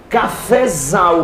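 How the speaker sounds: Cafezal stretched very slowly, leaning on the z; drawn-out demo. Shown as a demonstration. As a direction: spell Cafezal out slowly for the class. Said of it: "a fonologia vai nos permitir que você gere um Z".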